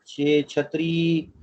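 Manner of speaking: छे छतरी drawn out very slowly; slow ch sound. Shown as a demonstration. Teacher slowly repeats छे छतरी for students, drawn out. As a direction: stretch छे छतरी syllable by syllable. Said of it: "और भी बड़े अल्फाज बनते हैं".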